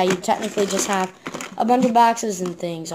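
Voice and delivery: usual speaking voice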